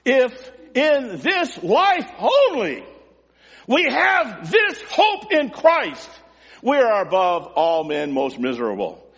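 high, drawn-out pulpit shout, falling at the end; shouting apostle. Acted. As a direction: cry it out slowly, stretching each word. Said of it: "Paul is shouting".

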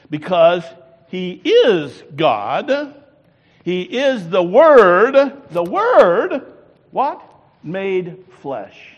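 loud, slow, drawn-out preaching rising to a call; preaching at full force. Acted. Preacher calling the congregation to answer, loud rising preaching. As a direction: lean in and hammer each phrase home.

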